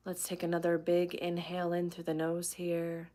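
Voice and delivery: soft, unhurried meditation voice with long pauses; guided meditation. Real, not acted. Teacher leading viewers into breathing and relaxation, guided meditation. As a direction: guide them gently through each breath.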